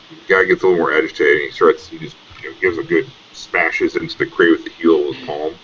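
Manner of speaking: ordinary talk, nothing marked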